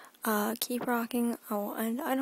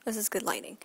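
ordinary talk

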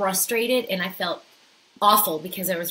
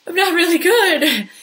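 loud, high hammed-up wail; mock sobbing confession; imitated crying; 11 semitones higher, 11 dB louder than usual. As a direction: act out a tearful blurt, pitched way up. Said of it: "I just started crying and crying".

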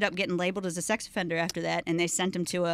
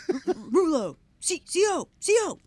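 high-pitched, stuttering call for attention; inmate calling officer; 9 semitones higher than usual. Retold or imitated.

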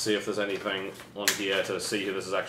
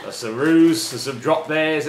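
louder, higher hammed-up Aussie twang; bad Australian accent; 5 semitones higher, 6 dB louder, slower than usual. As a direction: lay a mock Australian accent on thick. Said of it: "worst Australian accent".

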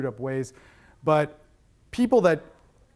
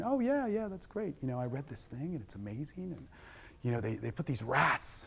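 mock yuppie; 12 dB quieter than usual. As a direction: imitate a clueless enthusiastic yuppie, mildly. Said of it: "some yuppie motherfucker at a conversation saying".

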